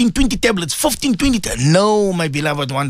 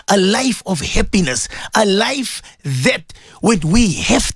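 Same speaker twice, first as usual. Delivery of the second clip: low, earnest supplication; leading a prayer; 4 semitones lower than usual. Real, not acted. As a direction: pray aloud for every listener, building phrase by phrase. Leads listeners in prayer.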